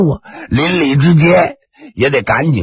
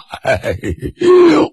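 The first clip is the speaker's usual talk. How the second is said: embarrassed laugh; 11 semitones higher than usual. Acted.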